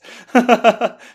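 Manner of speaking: big loud laugh; loud burst of laughter. Real, not acted. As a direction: laugh out hard. Laughs at the [name] bit.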